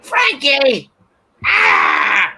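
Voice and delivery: high, loud mock yell of Frankie's name; yelling grandma. Grandma yelling for dad Frankie, loud mock yell. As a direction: call out like a fed-up grandma. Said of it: "she's yelling at my dad".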